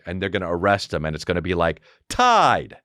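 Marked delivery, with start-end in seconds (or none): 2.1-2.8 higher, punched brand name; hammed-up commercial announcer; mock ad tagline. Announcer cuts in after the arrest, mock ad tagline. Parody ad's brand tag.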